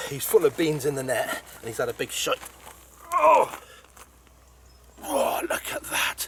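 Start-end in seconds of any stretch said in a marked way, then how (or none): 3.1-3.6 a sudden high, loud "Oh"; excited gasp. Real, not acted. Angler spotting his huge carp, excited high gasp. 5.0-6.3 slow breathless whisper, half crying; barely able to get the words out for awe; awed whisper. Real, not acted. Angler admiring his fifty-pound carp, awed slow whisper.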